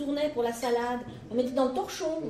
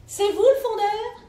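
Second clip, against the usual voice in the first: petite servante; 9 semitones higher, 9 dB louder than usual. Acted.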